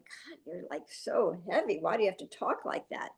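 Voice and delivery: quietly muttering your reaction to a heavy-voiced boss; hushed inner reaction. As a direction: say it under your breath, taken aback. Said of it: "you're yelling at me".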